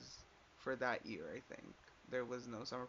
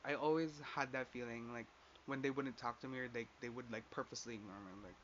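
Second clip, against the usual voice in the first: ordinary talk, nothing marked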